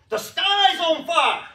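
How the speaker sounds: high, drawn-out yell; big Christmas-play shout; shouted stage line. Retold or imitated. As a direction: holler it out slowly and very high. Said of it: "one of the lines was".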